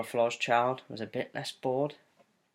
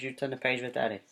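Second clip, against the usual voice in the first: ordinary delivery, nothing marked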